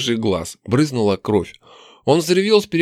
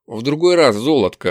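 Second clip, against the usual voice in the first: plain reading voice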